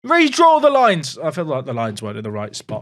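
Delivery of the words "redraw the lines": higher, slow, indignant appeal; over-the-top outrage. Real, not acted. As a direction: protest loudly to the referee. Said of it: "I've got over the top animated there".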